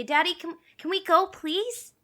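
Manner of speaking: put-on high, pleading little-kid voice; child asking dad. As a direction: beg sweetly, rising on the request. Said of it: "look at my dad and say".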